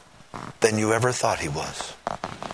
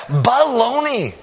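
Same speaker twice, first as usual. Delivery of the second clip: dismissive; 10 semitones higher, 5 dB louder, slower than usual. Real, not acted. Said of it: "heard people say".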